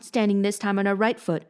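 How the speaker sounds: ordinary delivery, nothing marked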